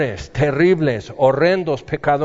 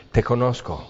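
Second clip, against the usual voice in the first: much softer, lower, gentle; tender welcome; 7 semitones lower, 7 dB quieter than usual. Pretend. Jesus welcoming the believer into joy, softly tender. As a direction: welcome them home gently.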